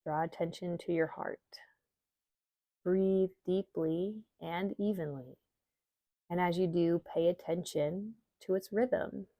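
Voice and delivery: calm, measured meditation reading with long pauses; guided meditation. Acted. Yoga teacher guiding listeners through an Imbolc visualization, meditation voice. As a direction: guide listeners gently inward, leaving space between phrases.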